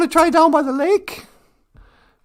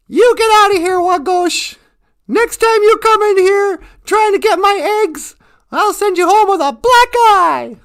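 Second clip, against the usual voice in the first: loud, raised-pitch telling-off; angry scolding; 4 semitones higher, 8 dB louder than usual. Acted. Chicken to the fox caught after her eggs, angry and loud. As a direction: chase the thief off with a threat.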